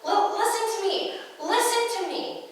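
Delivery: high, loud demand, tripping on the first sound; stammered yell. Retold or imitated. Birdie demands Lionel's attention, frustrated yell. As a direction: yell to be heard, insistently. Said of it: "And Birdie yells".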